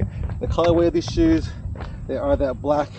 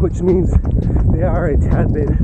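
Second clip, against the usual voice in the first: talking mid-run, louder over the stride; while running; 7 dB louder than usual. Real, not acted. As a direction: speak while jogging on a run. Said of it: "after mile one in the shoe".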